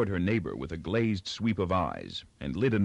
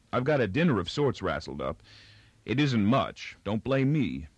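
ordinary delivery, nothing marked

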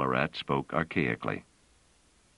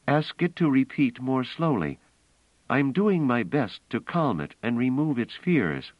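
higher-pitched, insistent story voice; earnest, raised-pitch character dialogue; earnest woman character, urgent; 8 semitones higher, 3 dB louder than usual. A narrator's reading.

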